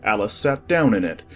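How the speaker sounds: normal talking voice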